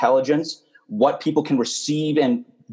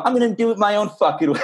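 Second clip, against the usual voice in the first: higher-pitched mock defiance, laughing at the end; rebel attitude; 8 semitones higher than usual. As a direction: play the rebel, half laughing. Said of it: "that's a rebel attitude".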